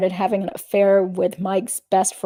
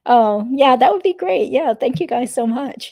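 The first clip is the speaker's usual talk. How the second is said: excited; 5 semitones higher, 6 dB louder than usual. Real, not acted. Reacting to good news.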